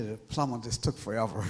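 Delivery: ordinary talk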